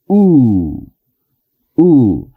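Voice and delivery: "U" held long with rounded lips. Shown as a demonstration. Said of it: "debemos de pronunciar como una U, pero larga".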